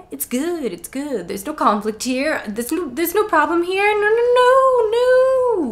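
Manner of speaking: backing away gently from a beast; placating a wild animal. Retold or imitated. Friends backing from wild animal, nervous soothing. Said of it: "where you're like".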